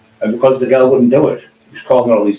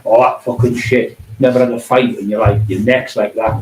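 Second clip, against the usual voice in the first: ordinary talk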